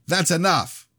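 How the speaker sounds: put-on aunt screaming at the boys to stop. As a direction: cut the fight off with a scream. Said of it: "Laura screamed".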